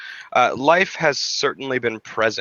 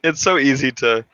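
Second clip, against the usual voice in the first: airy laugh into excited words; 3 semitones higher than usual. Real, not acted. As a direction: laugh then speak with delight. Laughs, delighted by shared interest.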